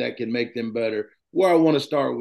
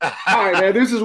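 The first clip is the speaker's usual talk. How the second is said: laughing; 8 semitones higher, 4 dB louder, faster than usual. Real, not acted. Host laughing after joke.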